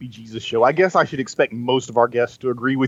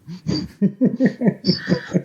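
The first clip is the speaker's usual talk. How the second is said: laugh; 8 semitones higher than usual. Real, not acted. Laughs at daughter's quote.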